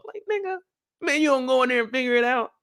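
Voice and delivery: can't believe this guy; incredulous. Real, not acted. Ranting to viewers about the doctor, high exasperated outburst.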